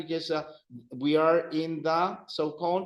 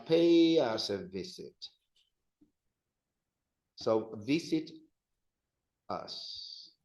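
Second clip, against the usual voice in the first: ordinary talk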